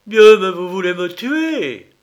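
high, loud cry of terror; terrified scream. Acted. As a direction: scream it in mad fear. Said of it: "Il poussa des cris de rage et de terreur folle".

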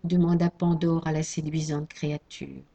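ordinary delivery

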